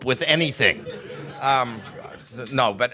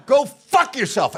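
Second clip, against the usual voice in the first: higher, louder mock yell as his mother; demented mom's furious yell; 11 semitones higher, 3 dB louder, slower than usual. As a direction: bark it at your son like an angry old mom. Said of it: "She said".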